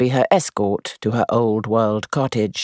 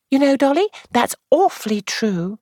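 lightly voiced, higher young actress; young woman voice; 13 semitones higher than usual. A narrator's reading. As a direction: play the actress, higher, confiding. Jane voiced high.

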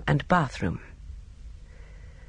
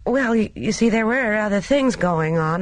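higher voice, stumbling into the sentence; rather hesitant; 7 semitones higher than usual. A narrator's reading. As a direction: explain awkwardly, with a slight stammer at the start. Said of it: "Gina spoke rather hesitantly".

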